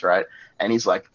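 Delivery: ordinary delivery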